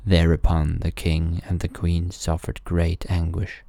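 plain reading voice

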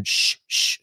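sharp hushing "shh, shh"; two unvoiced hushes; imitated shushing. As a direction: shush someone as in a retold scene. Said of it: "turn around to me and go".

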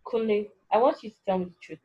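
plain reading voice

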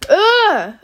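exasperated ugh. Real, not acted. Exasperated at the teacher.

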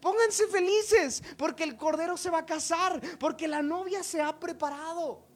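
high, loud herald's proclamation, easing toward the end; shouting. Acted. Messenger waking the brides before the wedding, shouting. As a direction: cry the good news out to all. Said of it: "y les gritó".

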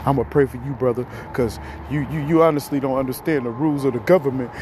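rattled off fast, preachy; quick mock sermon. Mocking a preachy brother, said fast. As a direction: rush it like a quick church aside.

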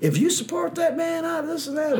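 high mock-indignant fan voice; mocking angry fans. Mocking fans who posted about [name], high and mock-indignant. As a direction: squeak it out, mocking them. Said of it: "They were just like".

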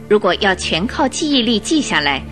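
ordinary delivery

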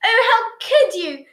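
high, loud, drawn-out reproach; scolding aunt. A narrator's reading. Aunt turns on the rest of the family, high scolding. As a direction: scold them in shocked reproach.

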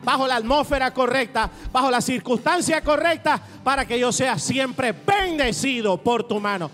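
loud, driving declaration in rhythmic phrases; prédica enérgica. Real, not acted. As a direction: preach it at full force, rallying the congregation. Declaración a plena fuerza. (prédica a toda voz, con ímpetu)

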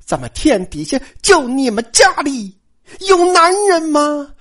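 high, indignant rhetorical question; mock-indignant rebuke; scornful scolding. A narrator's reading. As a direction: scold them, climbing high and stretching the last words. Third sister scornfully scolds them.

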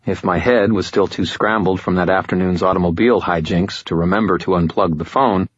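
ordinary delivery, nothing marked

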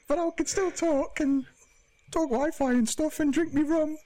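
mock post-operation squeak; put-on squeaky Geordie voice. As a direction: play a silly high-pitched Geordie character. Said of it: "You sound more Geordie when you do that voice".

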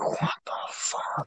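breathless whisper of disbelief; whispering in stunned disbelief at the drunk. Retold or imitated. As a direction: mutter it under your breath, no voice. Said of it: "And then I'm just like".

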